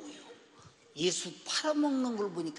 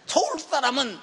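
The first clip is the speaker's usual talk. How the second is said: loud, high sermon joke through laughter; laughing loud preaching; 8 semitones higher, 12 dB louder than usual. Acted. Pastor teases the congregation about regional amens, laughing loudly. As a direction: tease the crowd while preaching hard.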